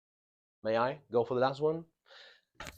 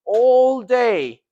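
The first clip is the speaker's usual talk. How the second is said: ALL stretched high and very loud, falling on DAY; emphasis on duration; 10 semitones higher, 11 dB louder than usual. Shown as a demonstration. Teacher reminding students of duration, emphatic. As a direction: draw out "All day" loudly, high-pitched. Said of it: "The emphasis is on the duration".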